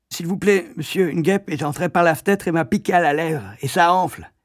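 high, odd-timbred pupil voice; stung schoolboy mumbling; boy with swollen lip. Acted. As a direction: speak through a swelling lip.

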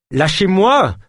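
high, loud cry to let go; desperate cry. Acted. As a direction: struggle and shout at his captor. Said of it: "cria-t-il". (cri désespéré, aigu et fort)

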